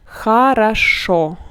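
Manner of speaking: Хорошо slow and loud as "kha-ra-SHO"; unstressed O as A. Shown as a demonstration. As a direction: stretch Хорошо, only the final o rounded, the others "ah". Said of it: "are pronounced like A".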